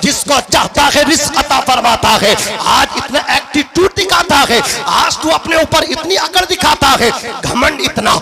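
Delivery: full-force sermon with music behind; preaching. Acted. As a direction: preach it out to the crowd.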